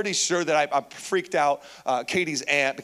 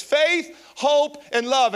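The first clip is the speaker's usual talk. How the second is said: high stressed faith, hope, love said slowly; slow, rising emphasis; 6 semitones higher, 4 dB louder, much slower than usual. Acted. Preacher proclaiming Paul's three words with emphasis. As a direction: lift each word like a proclamation.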